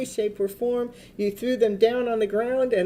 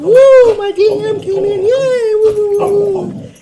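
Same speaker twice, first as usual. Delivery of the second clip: much higher, much louder shrieks of joy; wild celebrating; 11 semitones higher, 12 dB louder than usual. Pretend. Reader acting out the client's celebration, ecstatic squealing. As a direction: squeal like you just won big. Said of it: "You're gonna be celebrating".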